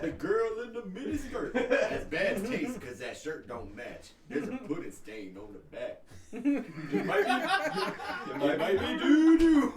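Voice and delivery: rapper voice. Retold or imitated. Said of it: "he's like".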